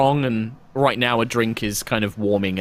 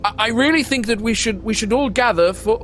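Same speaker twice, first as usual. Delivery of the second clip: high, thin, stammering priest; a nervous old priest at the door; dry high voice; 12 semitones higher than usual. Acted. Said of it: "a very kind of dry voice".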